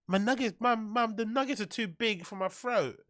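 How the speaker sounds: high, soft mock little-kid whine; whiny child. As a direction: whine to mum like a fussy child. Mocks child whining to mum.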